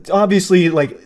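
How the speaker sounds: usual speaking voice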